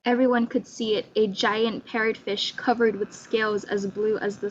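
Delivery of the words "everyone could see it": ordinary delivery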